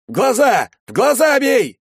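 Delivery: high, loud frantic shout; истошный крик. A narrator's reading. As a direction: yell the order frantically at the top of your voice. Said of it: "истошно закричал Карасик". (истошный крик бойца)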